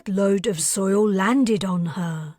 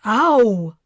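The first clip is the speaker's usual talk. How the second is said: pained ow; 2 semitones higher, 6 dB louder than usual. Acted. Forehead smacks hard surface.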